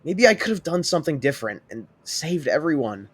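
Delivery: guilt-ridden, rising toward tears; guilty. Acted. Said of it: "guilt playing in his voice".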